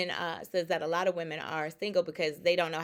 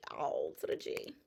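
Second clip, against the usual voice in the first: whispered echo. Pretend. Playful wordplay repeated.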